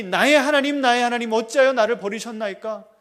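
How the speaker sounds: the Son on the cross, a loud anguished cry. Shown as a demonstration. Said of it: "그 아들이".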